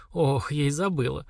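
ordinary delivery

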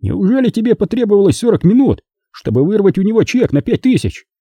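high-pitched exasperated question; impatient exclamation. A narrator's reading. Friend asks why the cheque took so long, exclaiming. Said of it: "воскликнул Билл Карлин".

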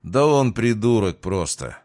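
plain reading voice

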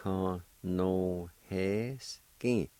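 "Kanoheskin" slowly, in separate syllables with short pauses; separated syllables. Shown as a demonstration. Teacher repeating the word for fable, "Kanoheskin" slow and divided. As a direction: draw out each syllable of "Kanoheskin". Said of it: "it's pronounced like this".

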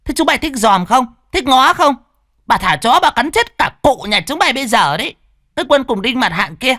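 very high, loud abuse at the neighbours; cursing shriek. A narrator's reading. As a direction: screech threats at the crowd. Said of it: "Nghe con Tâm chửi".

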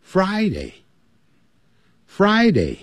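Friday drawn out slowly; slow model reading. Shown as a demonstration. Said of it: "我们现在就跟着讲".

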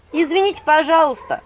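loud high apologetic shout; calling out. A narrator's reading. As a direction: call out an apology loudly. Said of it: "крикнула я".

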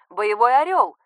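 loud, short battle cry; crowd's shout. A narrator's reading. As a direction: call it out like cheering fans. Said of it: "все болельщики Оберна закричали". (громкий выкрик болельщиков)

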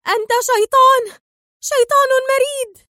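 very high, loud outraged cry; scream. A narrator's reading. Sonia cursing the man who tricked her, screaming. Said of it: "صرخت سونيا".

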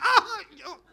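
high, loud yelp of surprise; mock startle. Churchgoer bumping into the brother he dodges, mock startle. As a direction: act caught out, pitch leaping up.

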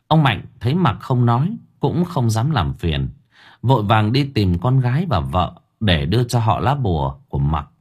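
usual speaking voice

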